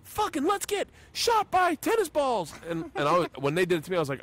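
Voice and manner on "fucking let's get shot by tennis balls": high, slow pumped-up yell imitation; imitating a guy way too eager for pain; full force mock hype. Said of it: "full force".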